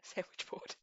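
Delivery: breathless whispered repeat. Real, not acted. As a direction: whisper the words back.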